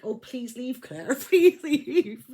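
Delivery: begging the player to leave, giggling; laughing plea. Retold or imitated. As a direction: beg him to go while giggling. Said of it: "I was like".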